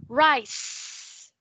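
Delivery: Rice ending on a crisp S; clear S sound. Shown as a demonstration. Teacher corrects Min, stressing the S. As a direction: lean on the final S of Rice. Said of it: "emphasize the S sound".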